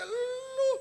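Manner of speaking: very high mock-brat address to father; imitating rude child. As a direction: mimic a child being insolent to Dad. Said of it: "coba, bapaknya". (menirukan anak kurang ajar kepada bapaknya)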